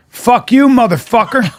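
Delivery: mock Alabama heckler yelling at a comic. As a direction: spit it at him like a tough Alabama woman. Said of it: "And a woman goes".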